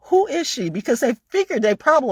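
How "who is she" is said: guys' disbelief. Retold or imitated. Retelling the athletes' shock, high mock voice. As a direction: play the baffled big guys. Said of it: "they're all looking like".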